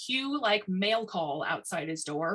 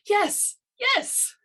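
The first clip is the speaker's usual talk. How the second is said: high, loud eureka; sudden delighted realisation; excited; 7 dB louder than usual. Acted. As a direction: yes yes, pitched high with joy. Tiny suddenly grasps the idea.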